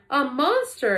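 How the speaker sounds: loud, high alarmed cry; frightened cry. A narrator's reading. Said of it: "Annie cried".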